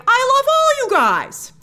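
high, loud, drawn-out adoring cry; gushing affection. Acted. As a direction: gush it slowly, way up high. Gushing contrast to the complaint.

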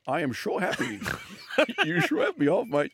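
high mock Scandinavian accent, laughing through it; mock Danish reading, pitched high and broken by laughs. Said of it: "In Danish?".

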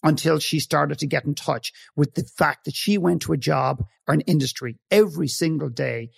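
usual speaking voice

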